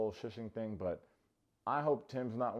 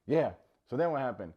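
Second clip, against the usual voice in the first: so then what happened, louder and keen; loud eager repeat; eager loud echo; 3 semitones higher, 7 dB louder than usual. Retold or imitated. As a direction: echo Monica louder and hungrier. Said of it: "And I'm in that bitch like".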